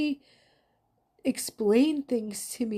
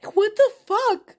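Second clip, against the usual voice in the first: much higher and louder, voice cracking open; raw outcry; 11 semitones higher, 8 dB louder than usual. Real, not acted. Woman vents rage at God over her loss, shouting. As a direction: shout it up at the sky, furious.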